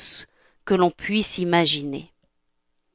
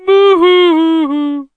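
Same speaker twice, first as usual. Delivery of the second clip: high, drawn-out crying boohoo; boohoo sob; 9 semitones higher, 8 dB louder, much slower than usual. Acted. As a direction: wail a slow sob. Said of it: "en pleurant".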